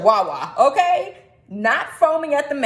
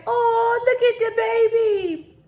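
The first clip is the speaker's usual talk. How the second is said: higher, slowed gushing coo; cooing at the baby; 11 semitones higher, slower than usual. Retold or imitated. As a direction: gush over a cute dog. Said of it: "when I say".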